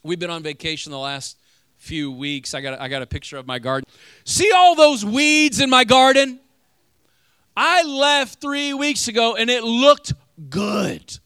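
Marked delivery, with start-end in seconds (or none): 4.2-11.2 booming preacher. Real, not acted. Preacher showing garden photo, booming.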